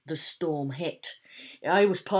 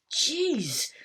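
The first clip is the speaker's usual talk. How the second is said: shout; 9 semitones higher than usual. A narrator's reading. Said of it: "shouted Dad".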